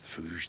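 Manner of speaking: phooge with long "oo" and soft zh; trying out pronunciations. Shown as a demonstration. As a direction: say phooge as "foozh". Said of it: "I don't know what the hell they call it".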